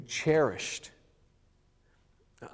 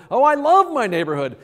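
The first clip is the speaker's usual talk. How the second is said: higher, louder gushing declaration; grand gesture; 9 semitones higher, 8 dB louder than usual. Pretend. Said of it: "responding with".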